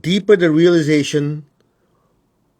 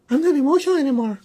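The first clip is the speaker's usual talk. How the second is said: much higher mock relief; surprised student; 13 semitones higher, faster than usual. Student finds the emotion gone, high mock surprise. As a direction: say it like a student suddenly realizing. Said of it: "at some point they go".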